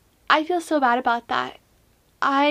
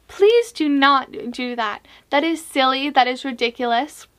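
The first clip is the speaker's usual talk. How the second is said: ordinary talk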